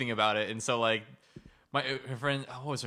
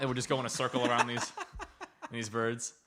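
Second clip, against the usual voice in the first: ordinary talk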